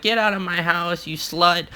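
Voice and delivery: high-pitched mock scolding, held back in volume; imitating pissed-off mom. Mom saying this to the girl, mock angry dismissal. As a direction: imitate mom throwing someone out, without shouting. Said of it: "my mom is just fucking pissed".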